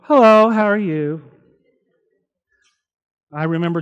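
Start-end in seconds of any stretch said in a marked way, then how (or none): 0.0-1.2 sweet, friendly caller voice, a bit louder; mock-cheerful hello after the fight; polite phone greeting. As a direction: switch to pleasant phone manners. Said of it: "You pick up the phone and go".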